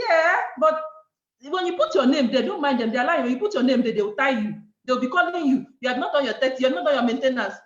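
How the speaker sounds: loud, insistent warning; alarmed objection. Retold or imitated. Wife warning husband against registering, loud and worried. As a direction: argue back forcefully, piling up objections. Re-enacts arguing fears to husband.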